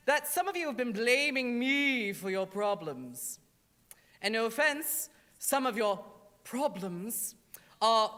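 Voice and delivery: put-on British accent as Satan, loud and grand; a haughty British Satan in a hammed-up British accent; a drag queen's campy British devil voice; fake British accent. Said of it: "that was a fake accent".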